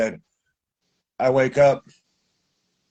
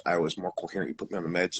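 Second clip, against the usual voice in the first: normal talking voice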